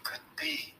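hushed, voiceless whisper. A narrator's reading. Tom whispering while standing his ground, a hushed whisper. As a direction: whisper it under your breath. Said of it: "whispered Tom".

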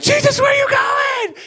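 very high, loud cry after him; high frantic yell; panicked shout. Acted. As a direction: call out in panic as he leaves. Disciples calling after Jesus.

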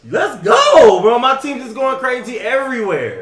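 loud, thrilled yell; excited shout. Real, not acted. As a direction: cheer it out loud. Hearing his team made finals.

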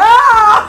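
loud, high shriek with laughter; comic yell of shock; mock startled scream. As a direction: scream as if caught in the act. Retelling baby's stare startling her.

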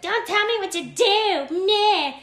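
high, loud, whiny defiance; petulant drunk talking back; bratty drunk. Retold or imitated. Voicing her drunk self.

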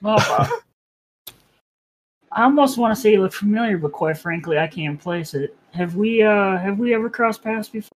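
high, fast and surprised; sudden lift of surprise. Acted. Vic talks to forgettable Anna.

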